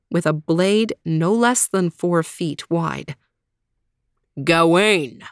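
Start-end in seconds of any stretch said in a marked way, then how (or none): 4.4-5.2 deep knight voice. Acted. Green Knight greets.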